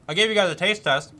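talking through a mouthful of cereal; words round a bite of food; mouth full. Real, not acted. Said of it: "Sorry to talk with my mouth full".

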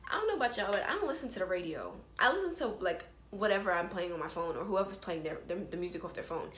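ordinary talk

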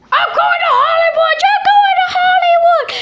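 high, loud shout of triumph; excited shout. Pretend. Contestant celebrating the judges' yeses, in a high triumphant shout. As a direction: yell it out like you just won. Celebrating getting through the audition.

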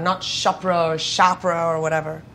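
normal talking voice

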